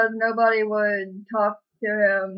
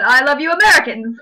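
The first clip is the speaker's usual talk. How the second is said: louder, chirpy fake British small talk; mock British accent; 3 semitones higher, 7 dB louder than usual. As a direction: lay the posh Brit on thick. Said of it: "How's that British?".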